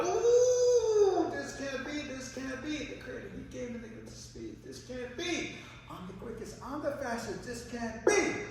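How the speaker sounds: high-pitched, incredulous rabbit voice; boastful rabbit refusing to believe it; rabbit in disbelief. Acted. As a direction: open on a loud high hoot, then protest in a high voice. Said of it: "The rabbit goes".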